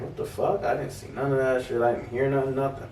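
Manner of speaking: quiet, stunned inner voice; hushed inner thought. Retold or imitated. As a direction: mutter it under your breath, high and soft.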